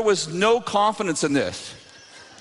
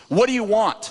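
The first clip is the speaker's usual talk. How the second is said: raised-pitch, imitated curt husband; gruff challenge; 4 semitones higher than usual. Reluctant husband challenging the preacher from far off, gruff demand. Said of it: "Like that".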